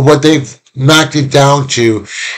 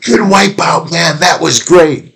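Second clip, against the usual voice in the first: high-pitched, playful congratulations; cheering passerby; 6 semitones higher than usual. Acted. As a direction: cheer on a fallen kid as you stroll by. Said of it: "walk by and go".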